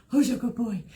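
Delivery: lightly cooing praise to a dog; a hint of a sweet pet-praise voice; talking to my dog voice. Owner praising her old Labrador during a bum scratch, light cooing. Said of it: "That was my talking to my dog voice".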